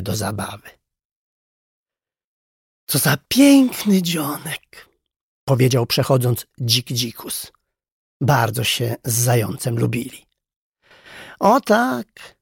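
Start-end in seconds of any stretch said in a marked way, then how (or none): 2.9-4.9 slow, drawn-out greeting of a storybook boar; lightly voiced, contented boar with stretched words; cheerful boar. A narrator's reading. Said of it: "Podśpiewywał sobie pod nosem". 11.4-12.4 high, cheerful agreement; joyful reply. A narrator's reading. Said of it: "odpowiedział radośnie nauczyciel".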